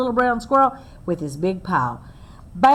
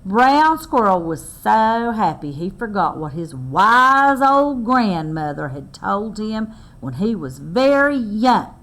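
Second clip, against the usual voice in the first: usual speaking voice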